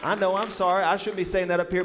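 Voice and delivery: ordinary talk, nothing marked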